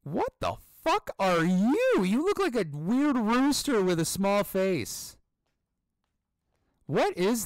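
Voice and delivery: loud, high-pitched bafflement; incredulous outburst. Real, not acted. Player reacting to a weird rooster-like character, incredulous. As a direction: exclaim it loudly, appalled and amused.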